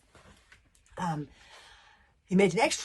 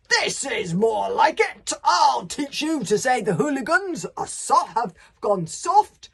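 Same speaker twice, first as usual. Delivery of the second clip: loud, slow roar of a chief spoiling for a fight; Viking battle cry; 6 semitones higher, 8 dB louder, slower than usual. Acted. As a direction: bellow it out like a warrior charging in. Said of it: "Stoic let out the Viking war cry".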